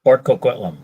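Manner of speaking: "Port Coquitlam" said as one name by a local; native's "Port Coquitlam", first part "Co", no L; local Canadian pronunciation. Shown as a demonstration. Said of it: "Now you say it".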